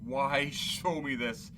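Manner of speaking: slow, then a loud anguished cry; despairing plea. Acted. Scrooge asks the phantom why, slow and then loud in despair. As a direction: drag out the question, then break into a loud plea.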